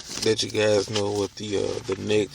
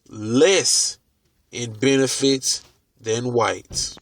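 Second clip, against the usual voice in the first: slow, deliberate read with long pauses; reading slowly. Real, not acted. Reader repeating a book passage for listeners, slowly. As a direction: read it out phrase by phrase, pausing after each. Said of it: "I'll read it slowly".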